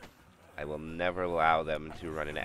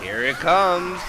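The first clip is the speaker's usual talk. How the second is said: higher, slower, louder build-up call; excited; 11 semitones higher, 12 dB louder, much slower than usual. Real, not acted. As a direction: draw out the big moment. Tense moment in match.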